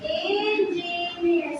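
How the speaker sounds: drawn out slowly, syllable by syllable; syllable counting. Shown as a demonstration. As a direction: stretch "Engineer" into three slow beats. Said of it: "So three".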